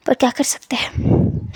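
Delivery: slow, soft unvoiced whisper; a sad little whispered aside. A narrator's reading. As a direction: lean in and whisper it. Narrator aside.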